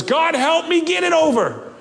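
high, pleading cry to God. Acted. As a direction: cry out for help in desperation. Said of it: "he just cries out".

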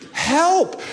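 preacher crying out to God. Acted. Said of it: "cry out".

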